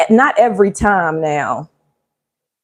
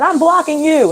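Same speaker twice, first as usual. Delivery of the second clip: higher, sassy mock declaration; sassy retort; 8 semitones higher than usual. Commenting to the TikTok lady, in a sassy higher retort. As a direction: toss it off like a mic drop. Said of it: "I commented in the chat and said".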